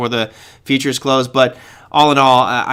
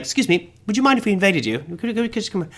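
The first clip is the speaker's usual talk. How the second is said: soft, higher-pitched polite British impression; British accent; 5 semitones higher, 6 dB quieter, faster than usual. Jokey polite Canadian invaders asking permission, in a soft mock British accent. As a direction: quietly put on a rough British accent, very polite. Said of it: "That's kind of a British accent".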